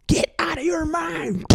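high manic shout; over-the-top party scream; mock hype yell. Coffee personified yelling.

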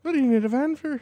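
hammed-up Scottish accent. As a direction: echo the line as a Mike Myers Scotsman. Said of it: "You're Scottish".

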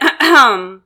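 short attention-getting ahem; pointed throat clearing. Retold or imitated. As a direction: clear your throat to be noticed. Standing over seat, demanding attention.

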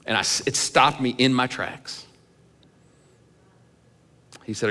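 ordinary delivery

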